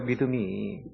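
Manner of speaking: ordinary talk, nothing marked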